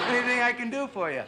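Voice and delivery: sugary host voice through laughter; laughing. Acted. Suddenly mock-friendly after the insult.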